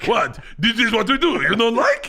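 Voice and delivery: mock Russian accent, insistent. Playing the baffled Russian performers.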